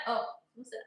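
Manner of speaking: ordinary talk, nothing marked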